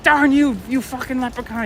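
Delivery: high, hammed-up cursing at the leprechaun; mock curse. Retelling the old man cursing the leprechaun, mock angry. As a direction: yell it like a broke old man mocking. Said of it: "He doesn't say it like that, but that's how I always say it".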